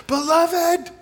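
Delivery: high-pitched exclaimed name; imitated yelling out. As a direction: yell it out like someone at the tables. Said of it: "They start yelling out".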